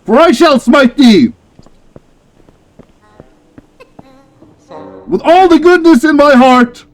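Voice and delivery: loud, high holy warrior proclamation; mock biblical prophet. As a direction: smite them with righteous fervor. Holy warrior challenge to evil.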